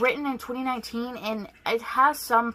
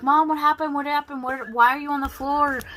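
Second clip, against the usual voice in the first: alarmed; 5 semitones higher, 6 dB louder than usual. Retold or imitated. Daughter wakes to find mom on floor.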